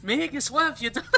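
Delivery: Princess Katharine impression. Streamer showing how the line sounds, Princess Katharine impression. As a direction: mimic a princess's lament, lilting up high with a laugh. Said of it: "it's Prince Catherine's voice".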